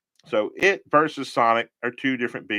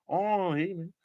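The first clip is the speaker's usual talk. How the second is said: lightly voiced Cheech Martin impression; 7 semitones higher, 5 dB quieter, slower than usual.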